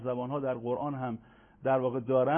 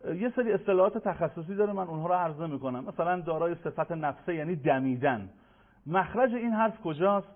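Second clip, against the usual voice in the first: usual speaking voice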